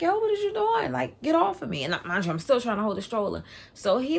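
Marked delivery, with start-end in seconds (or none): 0.0-1.8 high-pitched indignant retort; sharply rising, agitated challenge; retold confrontation, pitched way up; acted protest. Confronting man who grabbed at her.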